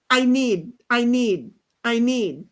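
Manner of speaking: "I need" repeated identically with pauses between; stuck-record repetition. Shown as a demonstration. Showing friends how she skipped, "I need" looping evenly. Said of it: "it was like this".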